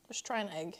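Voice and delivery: ordinary delivery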